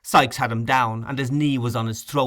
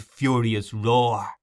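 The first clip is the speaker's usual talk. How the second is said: plain reading voice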